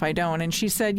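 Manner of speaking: ordinary talk, nothing marked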